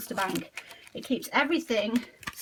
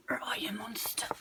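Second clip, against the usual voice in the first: hushed coaxing question; soft whisper, playful hunt; whispered search; 3 semitones lower, 11 dB quieter than usual. Real, not acted. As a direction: whisper to the monster card. Searching for the monster card.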